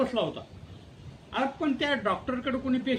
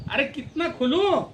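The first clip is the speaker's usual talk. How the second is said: exasperated patient; 5 semitones higher, 9 dB louder than usual. Acted. Patient protesting to the dentist about opening wider, loud and exasperated.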